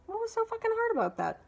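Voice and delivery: high-pitched indignant demand; exasperated retort. Retold or imitated. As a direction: throw it back at Mom in disbelief. Retold quarrel with mother.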